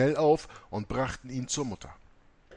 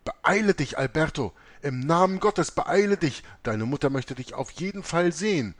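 high, loud hurried urging; anxious old woman urging haste; impatient urging; 6 semitones higher, 4 dB louder than usual. A narrator's reading. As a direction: push him to hurry, grandmother's impatience. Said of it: "verfolgte alles mit Ungeduld".

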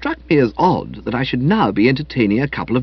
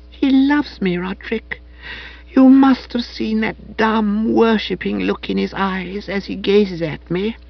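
put-on high, slow soppy girl; Madeline's voice; 8 semitones higher than usual. A narrator's reading. Madeline describing Bertie's adoring gaze, high and dreamy.

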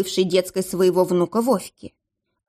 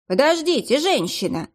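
calling out; 7 semitones higher than usual. A narrator's reading. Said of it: "крикнула она".

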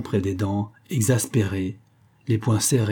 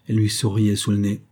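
plain reading voice

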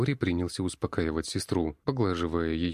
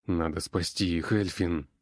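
usual speaking voice

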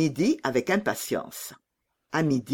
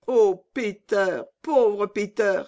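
put-on slow, higher little-girl sigh; sighing lament; 5 semitones higher, 3 dB louder, slower than usual. A narrator's reading. Gerda sighing for Peter in the cold, slow mild lament. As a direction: draw it out with mild pity. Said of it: "soupira Gerda".

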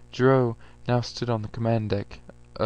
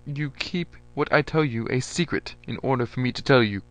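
higher, louder commanding voice; a general laying down terms; lightly voiced stern general; 5 semitones higher, 5 dB louder than usual. A narrator's reading. General demanding an oath.

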